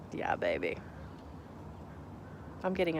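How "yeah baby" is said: much lower, hammed-up cool voice; mock low voice. Cheering her own Amazonite idea, mock low voice. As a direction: drop into a playful deep-guy voice.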